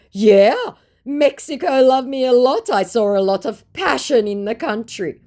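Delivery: high, loud put-on voice; hammed-up bragging; mock boastful scammer. Scammer bragging about Mexico in a high loud mock voice.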